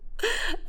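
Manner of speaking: short airy giggle; a breathless little giggle. A narrator's reading. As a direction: let out a quick teasing giggle. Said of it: "نوارة وهي بتضحك".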